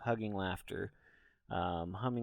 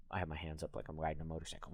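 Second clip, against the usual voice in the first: usual speaking voice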